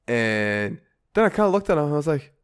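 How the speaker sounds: ordinary talk, nothing marked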